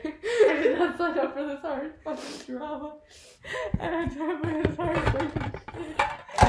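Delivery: crying, laughing protest; in tears. Real, not acted. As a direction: complain through real tears and laughter. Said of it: "is bringing you to tears".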